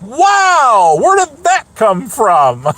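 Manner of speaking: high, loud acted astonishment; playful re-enacted amazement, drawn out at the end; amazed surprise. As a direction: exclaim it, stunned by an unexpected zinger. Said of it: "he will surprise the living hell out of me".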